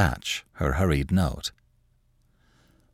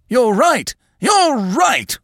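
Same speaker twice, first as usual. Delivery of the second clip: very high, loud agreement; frenzied old man's outcry; excited cry; 5 dB louder, much slower than usual. Acted. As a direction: burst out, climbing higher on the repeat. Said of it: "cried Mister Craven, springing up excitedly".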